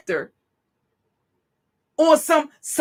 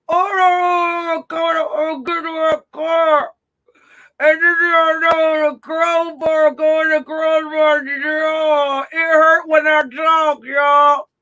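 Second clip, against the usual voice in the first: mock injured victim; slower than usual. Mocking the beaten woman's voice.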